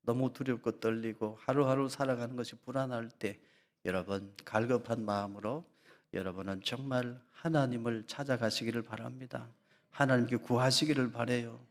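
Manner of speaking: ordinary talk, nothing marked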